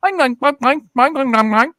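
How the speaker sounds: high-pitched gibberish imitating Chinese; mock Chinese. Imitating a friend greeting a stranger, mock Chinese. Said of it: "he starts speaking in Chinese".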